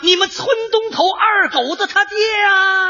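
high, drawn-out call. Acted. Soldier questioning the enemy across the lines, calling out. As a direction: holler it slowly across a long distance.

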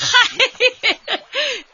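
loud, high hearty laugh. Acted. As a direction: laugh off the thanks warmly. Hearty laugh brushing off thanks.

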